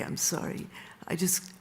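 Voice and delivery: hushed, breathy sorry after the shout; whispered apology. Retold or imitated. Don, embarrassed before the restaurant, whispers his apology. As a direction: whisper it, ashamed of the scene.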